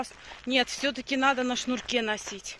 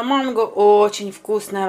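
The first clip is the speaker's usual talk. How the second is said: usual speaking voice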